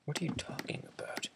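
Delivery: fast teasing whisper. Real, not acted. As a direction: hiss it quickly under breath.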